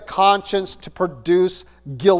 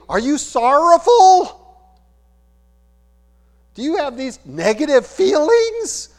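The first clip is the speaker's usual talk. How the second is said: mock doctor's questioning; 9 semitones higher, 5 dB louder than usual. Doctor screening a patient for bad feelings, mock questioning. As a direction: push the questions up high and incredulous.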